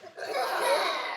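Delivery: breathy high giggle; high unvoiced laugh. Real, not acted. Laughing at the kids' cat noises.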